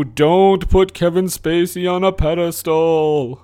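ordinary talk

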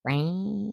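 long, soft drawn-out meow; stretched-out quiet meow; imitated cat yowl. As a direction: mimic a cat's slow whine. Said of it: "she just goes".

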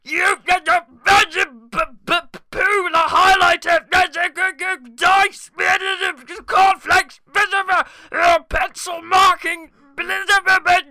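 high, loud, furious ranting list; angry shouting. Pretend. Feature Creature hurls an angry rant at the kidnappers. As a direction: yell every item like you're losing it. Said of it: "He sounds angry".

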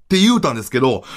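ordinary delivery

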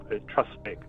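ordinary delivery

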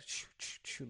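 soft unvoiced shoo, shoo; shooing whisper. Customer waving the server off, whispered shoo. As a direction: breathe a dismissive shoo, shoo. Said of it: "like, the hand movements".

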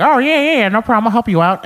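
high, loud cartoon dad voice; helpful goofy cartoon dad on a call; Peter Griffin. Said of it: "I was doing that Peter Griffin impression".

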